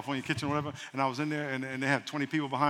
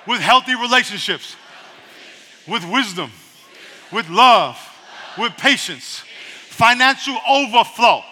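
loud, high preaching call and response; 6 semitones higher, 10 dB louder than usual. Acted. Pastor leads the congregation in prayer, preaching loudly. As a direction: lead the church in prayer, full force.

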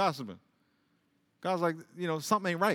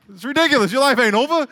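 loud, higher preaching; forceful rebuke; 8 semitones higher, 8 dB louder than usual. Real, not acted. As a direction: call it out to the crowd. Preacher raising voice.

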